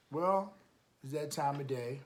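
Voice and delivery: plain reading voice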